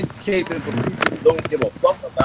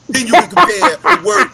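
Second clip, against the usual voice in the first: heated outburst; 10 semitones higher, 6 dB louder than usual. Real, not acted. Heated rant, voice jumps up.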